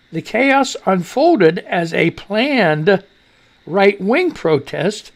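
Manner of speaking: very slow, each word set apart; deliberate slow rereading of "The chaos unfolded as a planned"; careful slow reread. Shown as a demonstration. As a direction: read it slowly so it lands right. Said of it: "we gotta get it right for you".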